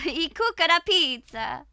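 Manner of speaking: loud, hammed-up Italian accent. Trying an Italian accent on a pizza line, loud mock Italian. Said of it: "I can just do an Italian accent".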